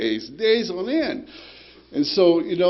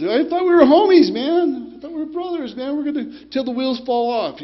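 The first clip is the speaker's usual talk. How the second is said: much higher and louder, indignant; hurt disbelief; 12 semitones higher, 6 dB louder than usual. Retold or imitated. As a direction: voice leaps up, stung by betrayal. Betrayed by friends he grew up with.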